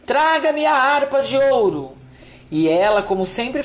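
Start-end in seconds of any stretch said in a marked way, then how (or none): 0.0-1.9 giant shouting. A narrator's reading. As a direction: yell the order across the hall. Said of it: "o gigante gritou para a mulher".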